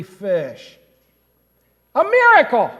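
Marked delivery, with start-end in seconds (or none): 1.9-2.7 loud, high burst of wonder; amazed exclamation. Acted. Preacher telling of the huge catch, amazed exclamation. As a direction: proclaim it as a marvel.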